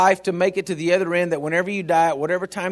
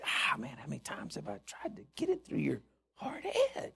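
exasperated whisper; 3 semitones lower, 15 dB quieter than usual. Pretend. Exasperated God voiced.

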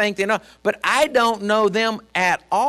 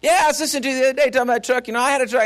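fast, chatty fan imitation; rattling on; 4 semitones higher, much faster than usual. Overfamiliar stranger chatting to the pastor about trucks, rattling on. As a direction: go off on a quick ramble. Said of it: "he just goes off like that".

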